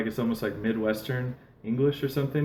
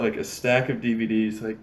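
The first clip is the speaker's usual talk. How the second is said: ordinary talk, nothing marked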